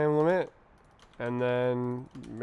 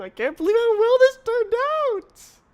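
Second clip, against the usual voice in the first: very high, loud squeaky exclamation; mock amazed squeal; 10 dB louder than usual. Coder marvelling at how well this turned out, mock squeal. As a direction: squeal it in exaggerated disbelief.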